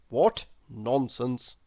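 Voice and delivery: usual speaking voice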